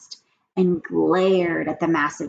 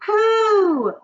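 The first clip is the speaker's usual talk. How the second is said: very high loud pooh; disgusted witches; 11 dB louder than usual. Acted. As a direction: squeal in disgust. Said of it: "cried the audience".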